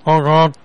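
clown horn honks; silly clown. Acted. Prank caller honking like clown.

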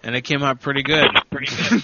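ordinary delivery

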